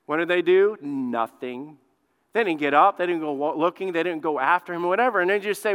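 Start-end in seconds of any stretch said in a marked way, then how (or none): none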